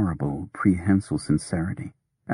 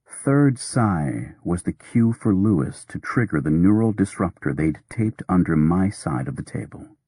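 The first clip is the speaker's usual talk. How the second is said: ordinary delivery, nothing marked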